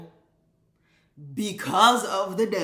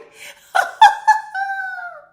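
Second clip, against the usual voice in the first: loud cackle; 11 dB louder than usual. Real, not acted. Bursts out laughing mid-rant.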